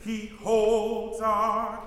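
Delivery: "He holds our" slow, with a small break before "holds"; slow lyric with clear H. Shown as a demonstration. Director demonstrating the separation between he and holds. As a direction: breathe out the H of "holds" after "He". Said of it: "we can hear the H of holds".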